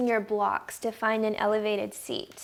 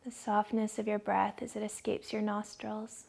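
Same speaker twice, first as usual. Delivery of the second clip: guided meditation; 6 dB quieter than usual. Acted. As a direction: ease them into calm, dropping to a whisper on the breath.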